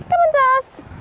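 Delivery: high, loud beckoning call. Real, not acted. Owner urges Darcy to come, high calling. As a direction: urge the horse over from afar.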